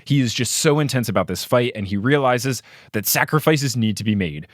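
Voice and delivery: ordinary talk, nothing marked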